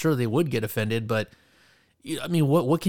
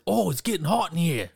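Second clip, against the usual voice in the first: higher-pitched, badly faked Australian accent; 6 semitones higher than usual. Quoting another joke from the special, poor Australian accent. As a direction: impersonate an Australian, poorly and pitched up. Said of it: "try to impersonate an Australian accent very poorly".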